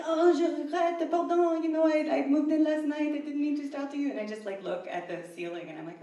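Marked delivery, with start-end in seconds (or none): 0.1-4.1 high, loud French-guy impression; apologetic French stranger. French guy explaining he moved in last night, flustered apology. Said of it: "French guy just standing in the middle".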